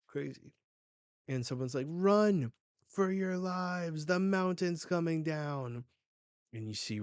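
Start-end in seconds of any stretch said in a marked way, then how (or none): 1.9-5.8 high, loud, drawn-out warning cry; mock panicked shout. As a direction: call out an alarm, acting it up.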